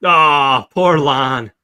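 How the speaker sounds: loud, drawn-out pitying groan; mock lament. Real, not acted. Host pitying doomed Lonnie, mock lament. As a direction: wail it in sympathy, falling away.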